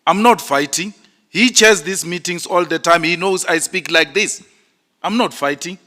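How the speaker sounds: shouting in protest. Real, not acted. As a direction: protest at full volume while denying you're fighting. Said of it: "you are shouting now, not speaking".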